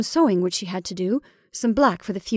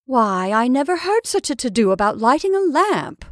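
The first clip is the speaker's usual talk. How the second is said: exasperated exclamation; 4 semitones higher, 5 dB louder than usual. A narrator's reading. Sister exclaims at the fuss.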